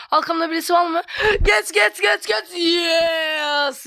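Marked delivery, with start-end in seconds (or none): 1.4-3.9 will the car past at the line; excited urging. Real, not acted. Overtaking at finish.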